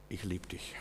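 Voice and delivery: viel leiser und tiefer, fast gehaucht; leises Bekenntnis. Retold or imitated. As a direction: ganz leise und hingegeben sagen. Prayer of surrender to God. (Beter zu Gott, ganz leise und hingegeben)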